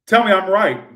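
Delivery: loud demand. Real, not acted. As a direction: call it out at the viewer. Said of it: "Sorry, what am I?".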